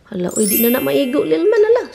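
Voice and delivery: loud, high-pitched fussing; alarmed exclamation. Real, not acted. Parent seeing little man's head stuck, alarmed exclamation. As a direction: pitch climbing in sudden dismay.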